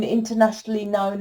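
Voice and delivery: usual speaking voice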